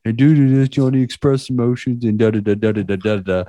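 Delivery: hammed-up reassuring pal rattling it off; mock soothing pep talk. To crying friend at the bar, hammed-up fast reassurance. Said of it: "What I said was like".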